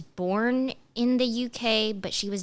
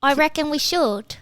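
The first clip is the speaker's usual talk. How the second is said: put-on British accent; 6 semitones higher, 6 dB louder than usual. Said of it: "bad British accent".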